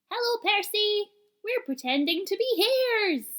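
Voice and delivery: high, loud cheerful calling; young rabbits shouting a happy hello; excited rabbit call. A narrator's reading. Rabbits calling out to Percy, high and excited.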